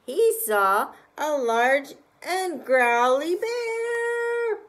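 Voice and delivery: high, loud, slow reveal; big dramatic reveal. A narrator's reading. Reader revealing the scary reflection, loud and slow. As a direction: land the surprise big and slow.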